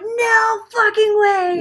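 loud, high, drawn-out yell; excited shout. Retold or imitated. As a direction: yell it in stunned delight. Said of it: "and we're like".

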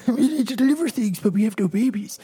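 high, silly talking-bird voice; stork voice. Acted. Host voicing jobless storks without babies, high stork voice. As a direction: plead high, as a cartoon stork. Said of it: "that's how storks talk".

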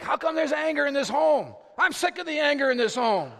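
angry complaint. Acted. Fed-up dad complains to the household, in an angry mock outburst. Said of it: "you sound angry".

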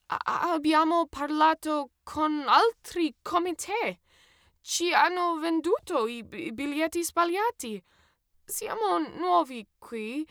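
high, slow, pleading foreigner's Italian; halting Italian. A narrator's reading. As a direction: stumble loudly through a plea in learner's Italian. Narrator's halting Italian plea to checker.

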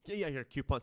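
ordinary talk, nothing marked

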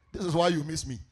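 ordinary talk, nothing marked

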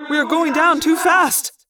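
high, drawn-out alien alarm; urgent warning. Acted. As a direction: sound the alarm from the controls. Alien pilot warns of crash.

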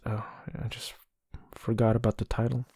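whisper. Real, not acted. Aside to self after flubbed title.

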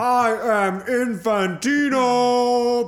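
booming wrestler promo yell; megalomaniac rant. Pretend. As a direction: roar it like a pro wrestler. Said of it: "sounds like a wrestler the way you do it".

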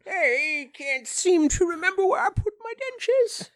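very high, put-on old lady voice; British old lady. Co-host plays an old lady hunting for dentures, high British granny voice. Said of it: "British old lady?".

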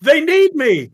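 eager hero rushing in; heroic eager. Acted. Leary rushing to help.

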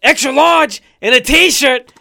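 loud, high, breathless rap shout; rapper yell. Retold or imitated. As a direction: yell the bars, gasping. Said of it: "He was like".

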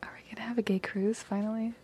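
hushed whispered aside; whispering. Real, not acted. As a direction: whisper it like a secret.

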